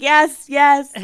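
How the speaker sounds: loud, high eager yes; excited yes. Retold or imitated. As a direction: blurt it out eagerly. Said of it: "I'm like".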